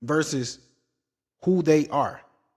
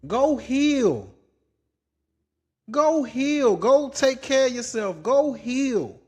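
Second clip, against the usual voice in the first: higher, insistent repeated urging; exasperated urging; 7 semitones higher, slower than usual. Real, not acted. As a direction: press her firmly to go heal, voice pitched up. Urging the crying vlogger to heal.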